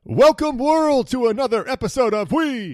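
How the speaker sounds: loud, high announcer welcome; announcer shout. Acted. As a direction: boom it out like a show host. Big show opener.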